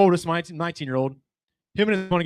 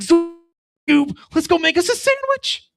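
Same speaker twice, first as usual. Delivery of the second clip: very high, loud cartoon voice; goofy Scooby-Doo sidekick squeal; tripping kid talking to the dog like Shaggy; Shaggy impression; 13 semitones higher, 5 dB louder than usual. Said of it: "talking to my dog like".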